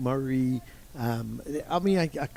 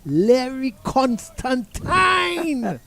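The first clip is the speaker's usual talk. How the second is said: drawn-out, slow, high and louder Jamaican shout of the name; Jamaican coach's exclamation; 10 semitones higher, 7 dB louder, much slower than usual. Retold or imitated. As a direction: relish the name in Jamaican, very slowly. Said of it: "he said".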